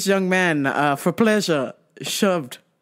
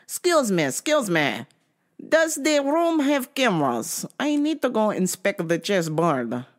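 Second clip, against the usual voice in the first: put-on playful Russian accent, a bit higher; 4 semitones higher than usual. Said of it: "I should probably do a Russian accent for that".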